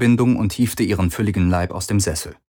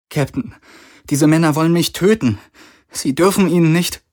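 higher-pitched, fearful plea; frightened pleading; 8 semitones higher, 3 dB louder than usual. A narrator's reading. Kirai begs the captain not to betray her, frightened. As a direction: plead for your life in a raised pitch. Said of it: "die Furcht in ihren riesigen Augen".

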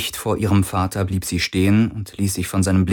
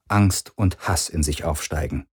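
ordinary delivery

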